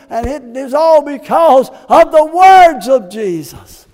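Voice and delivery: loud, driving preacher's cry; full-force preaching. Acted. Preacher stirring the congregation, loud full-force preaching. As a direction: preach it to the rafters.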